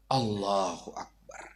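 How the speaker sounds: low, slow reverent call; solemn takbir. Real, not acted. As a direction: intone it with reverence.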